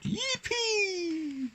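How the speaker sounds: high, drawn-out whoop; jubilant cry. A narrator's reading. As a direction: cheer it out very high and long. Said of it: "the tees cried out".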